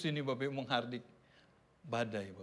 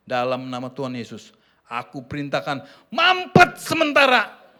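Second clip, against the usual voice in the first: mock commanding prayer; 7 semitones lower, 7 dB louder than usual. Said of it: "saya bilang gini".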